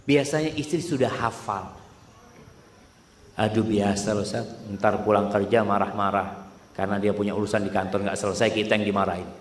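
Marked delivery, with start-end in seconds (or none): 3.4-9.3 imitating a complaining wife. Wives' complaint voiced by preacher.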